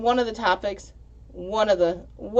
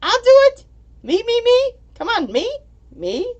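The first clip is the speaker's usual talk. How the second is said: much higher and louder, begging to go first; eager kid volunteering; 9 dB louder than usual. Retold or imitated. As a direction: jump up and down shouting pick me. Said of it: "He's like".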